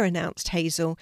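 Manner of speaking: plain reading voice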